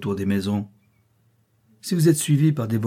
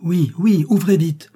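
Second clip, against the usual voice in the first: loud and high, calling through the door; urgent call to the servant to open up; urgent shout; 7 semitones higher, 5 dB louder, slower than usual. Acted. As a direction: shout to be let in from the rain. John urgently answers through door.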